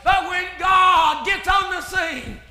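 loud, driving revival preaching; fervent preaching. Acted. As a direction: preach it hard, line by line. Preaches at full force.

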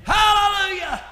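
loud, driving revival preaching; fervent preaching. Acted. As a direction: preach it hard, line by line. Preaches at full force.

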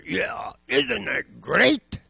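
loud, drawn-out glee, imitating an aunt; delighted old aunt. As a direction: crow it slowly, as the thrilled aunt. Aunt delighted she will die.

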